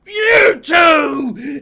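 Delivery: put-on high, loud drunken yell; drunk shout. Drunk patron snapping at two strangers, shouting. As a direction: bellow it at both of them like a drunk. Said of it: "and just".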